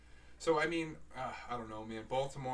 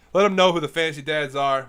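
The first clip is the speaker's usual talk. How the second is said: excited shout; 3 semitones higher, 16 dB louder than usual. Real, not acted. Hyping up the show's audience.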